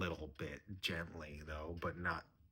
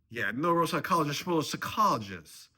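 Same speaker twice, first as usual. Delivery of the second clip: loud, high derisive jeer; scornful mocking; 9 semitones higher, 10 dB louder than usual. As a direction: jeer at his title, raised voice. Father-in-law mocks son-in-law's job.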